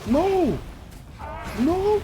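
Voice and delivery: high, loud cries of no; yelled protest. Real, not acted. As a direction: cry out at a bad turn in the game. Protesting at game events.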